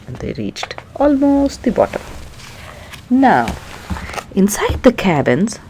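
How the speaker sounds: ordinary delivery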